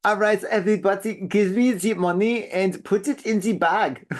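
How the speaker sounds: hammed-up German bank robber; German accent. Shown as a demonstration. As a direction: do a cartoonish German-accented hold-up. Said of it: "let me see if I can do that again".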